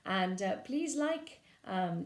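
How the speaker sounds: usual speaking voice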